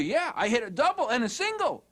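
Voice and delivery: put-on high-pitched proud kid; lightly voiced excited youngster; excited daughter. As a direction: play a child eagerly reporting. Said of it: "she's all excited".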